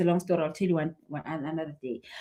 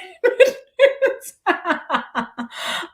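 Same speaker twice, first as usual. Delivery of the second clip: high, loud laughter; hearty laugh; 10 semitones higher, 5 dB louder than usual. Real, not acted. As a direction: burst out laughing for real. Laugh alone.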